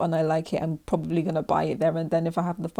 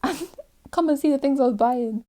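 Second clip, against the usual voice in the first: excited; 7 semitones higher, 5 dB louder than usual. Retold or imitated. Excited shopping splurge.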